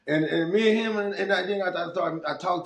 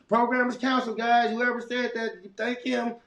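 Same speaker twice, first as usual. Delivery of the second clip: imitating her loudspeaker announcement, pitched higher; mock the boss cancelling program over the PA; 10 semitones higher than usual. The boss cancelling program over the loudspeaker, mock announcement. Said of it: "she'll say that on the loudspeaker".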